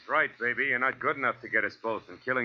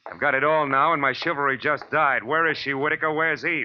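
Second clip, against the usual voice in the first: loud, higher, insistent questions; angry demand; 7 semitones higher, 5 dB louder than usual. Acted. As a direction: press him hard for an answer. Detective demands where Eve is.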